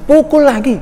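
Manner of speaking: very high, imitated emphatic command; insistent order. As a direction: act grandma overruling the teacher. Acted grandmother repeats her order.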